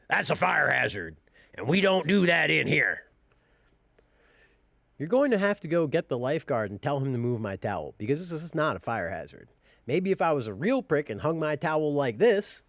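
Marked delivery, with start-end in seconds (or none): 0.1-9.5 lower, stern mock old man. The old man scolding about the towel, lightly mocked stern voice. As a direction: lecture like the fussy old man.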